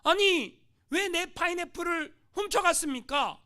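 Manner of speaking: loud, high, slow indignant protest; missionary confronts thieving natives, angry and loud; angry demand. Acted. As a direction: demand it back, outraged. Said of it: "화가 났어요".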